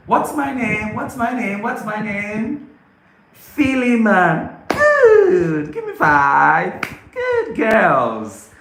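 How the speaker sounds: high, slow, cheerful classroom voice; playful teacher praise; demo teacher to kids. Shown as a demonstration. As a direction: coax the children like a kindergarten teacher.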